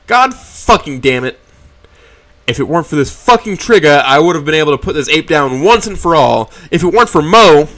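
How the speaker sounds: raging curse at the lost chance; furious. Acted. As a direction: spit it out, furious she got away. Narrator raging at missed chance.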